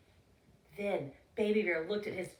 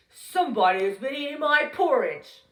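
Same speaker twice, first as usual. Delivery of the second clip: a hint of a big loud bear, louder; growly, loud Papa Bear; 3 semitones higher, 9 dB louder than usual. Papa Bear finding his porridge eaten, loud growl. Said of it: "in his growly, loud voice".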